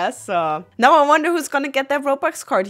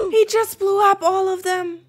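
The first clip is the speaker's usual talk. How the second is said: high-pitched shocked disbelief; stunned; 8 semitones higher than usual. Pretend. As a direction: report it aghast, pitched way up. Watcher reports the explosion.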